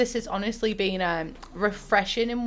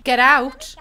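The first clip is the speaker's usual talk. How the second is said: sharp loud dismissal, pushed out; loud get out; 4 semitones higher, 9 dB louder than usual. Acted. Gamer yelling at her sim to leave. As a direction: shove them away with your voice.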